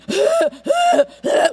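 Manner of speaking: sharp strained gasp for air; choking gasp. Shown as a demonstration. Preacher miming choking, a strained gasp. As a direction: gasp like you can't breathe out.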